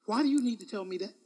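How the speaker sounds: usual speaking voice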